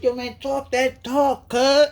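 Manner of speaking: high, slowed voice of the spirits urging him on; spirit beings voice. Retold or imitated. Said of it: "Beings on the other side like".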